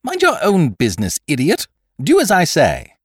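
high, loud impatient order; losing patience. A narrator's reading. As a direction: snap at a bungling underling. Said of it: "He lost patience".

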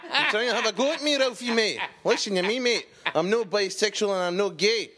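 high, fast Scottish bloke voice through laughter; mock the cousin's Glasgow accent, cracking up; laughing Glasgow cousin. Imitating his Glasgow cousin to the podcast hosts, high and laughing.

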